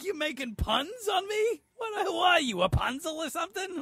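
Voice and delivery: very high, incredulous outburst; mock indignation. Driver challenging the cop over puns, mock indignation. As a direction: squeal it in put-on outrage.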